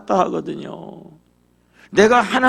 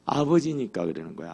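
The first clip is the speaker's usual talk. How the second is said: usual speaking voice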